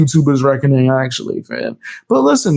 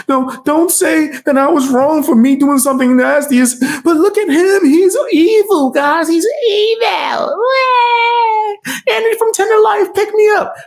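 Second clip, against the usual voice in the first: mock crying whine; 12 semitones higher than usual. Mocking his rival as a crybaby wailing about him. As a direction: ham up a crybaby wail.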